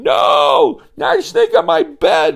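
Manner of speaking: put-on a kid shrieking at a snake on his bed; scream. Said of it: "And Joey screamed".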